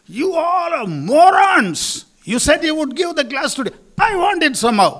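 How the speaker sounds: imitated furious customer yelling at the girl; angry shouting. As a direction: rage at the shop staff. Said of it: "he said".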